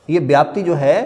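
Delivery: normal talking voice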